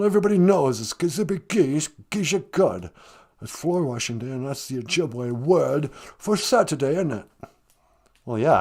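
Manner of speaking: ordinary delivery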